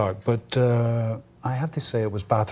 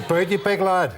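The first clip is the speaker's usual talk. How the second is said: Belfast greeting; 7 semitones higher than usual. Shown as a demonstration. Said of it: "that's a".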